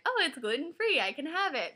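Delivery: high, bright pretend excitement; mock excited. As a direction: gush like you found a loophole. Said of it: "being like".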